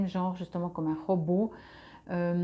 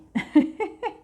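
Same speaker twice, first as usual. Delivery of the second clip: high laugh; 10 semitones higher, 7 dB louder than usual. Real, not acted. Laugh after whispered aside.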